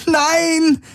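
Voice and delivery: very high desperate shout; shrill cry of refusal; scream. A narrator's reading. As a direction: scream against the arrest. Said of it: "schrie Kirai".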